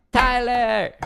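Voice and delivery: high, loud held call of a name; calling out a greeting. Real, not acted. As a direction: sing it out loudly to someone arriving.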